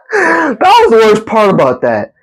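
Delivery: ordinary talk, nothing marked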